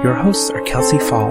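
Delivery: normal talking voice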